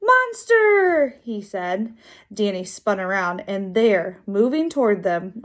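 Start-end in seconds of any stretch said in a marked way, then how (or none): 0.0-1.2 put-on very high, loud child's cry; high-pitched drawn-out warning; alarmed little kid. A narrator's reading. Kid sees a monster, warns.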